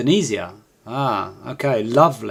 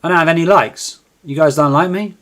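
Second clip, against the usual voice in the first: ordinary talk, nothing marked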